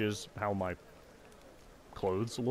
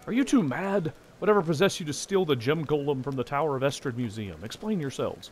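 high, loud scolding officer; stern commander; 5 semitones higher, 4 dB louder than usual. Acted. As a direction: demand answers from the rowdy pair.

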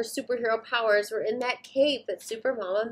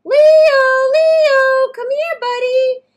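much higher and much louder calling out; shouting a name; 14 dB louder, much slower than usual. Acted. Boy calling his lost puppy across the park, shouting. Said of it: "running and shouting".

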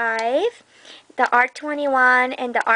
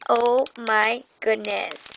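ordinary talk

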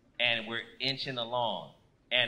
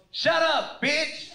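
loud, high mock shout; imitating a furious driver yelling; angry retort; 12 semitones higher, 4 dB louder, slower than usual. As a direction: shout her down rudely. Driver yells back at wife.

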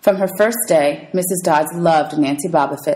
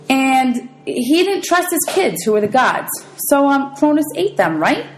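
nervous student; 8 semitones higher than usual. Acted.

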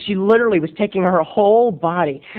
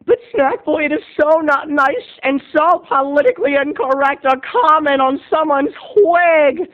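higher, prissy scolding voice; imagined heckler; 8 semitones higher than usual. Acted. Audience member scolding Snack Boy for mocking a wig, higher voice. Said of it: "heckler voice scolding the host".

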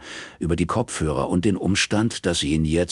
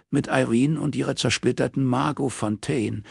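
reminding him; 7 semitones higher than usual. A narrator's reading. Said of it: "rief Robin ihm ins Gedächtnis".

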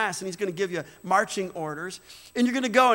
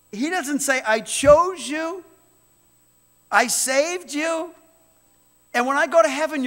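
loud, high, fervent preaching to the church; pulpit-pounding sermon voice; full-force preaching; 5 semitones higher, 5 dB louder than usual. Acted. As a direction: preach it out to the whole room.

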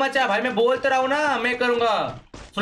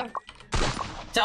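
ordinary talk

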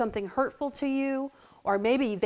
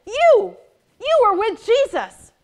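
put-on accusing servant girl; 11 semitones higher, 11 dB louder than usual.

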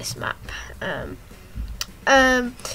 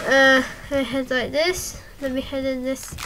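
ordinary talk, nothing marked

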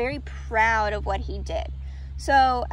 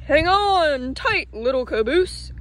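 calling out; 4 semitones higher than usual. A narrator's reading. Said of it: "called the flat car".

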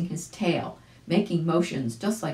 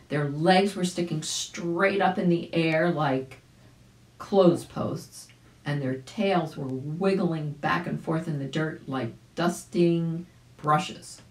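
ordinary delivery

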